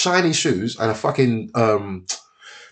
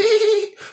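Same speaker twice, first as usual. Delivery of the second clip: very high mock giggle; imitated girlish giggle. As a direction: mimic giggling girls in a squeaky high pitch. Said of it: "The girls giggling and that".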